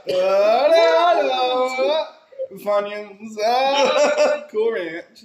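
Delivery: high, loud, cracking "What?" cries; excited snack freakout. Retold or imitated. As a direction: shriek over the snacks like it's a miracle. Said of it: "I'm like".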